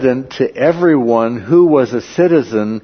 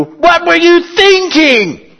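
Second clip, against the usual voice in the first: slow, much higher panicked cry; panicked disbelief; 7 dB louder, slower than usual. Retold or imitated. Centurion scolding the commander, high panicked disbelief. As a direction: stretch it out in horrified alarm. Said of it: "Equivalent to".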